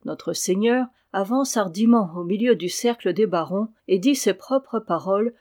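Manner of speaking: plain reading voice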